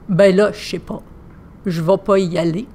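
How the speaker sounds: practised Québécois accent, lightly put on. Shown as a demonstration. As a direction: imitate a casual Québécois accent. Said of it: "j'ai transformé mon accent en accent québécois". (accent québécois légèrement appuyé)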